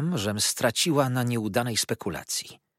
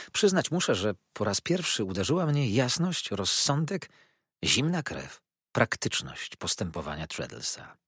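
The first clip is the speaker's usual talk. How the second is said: usual speaking voice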